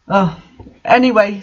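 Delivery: ordinary delivery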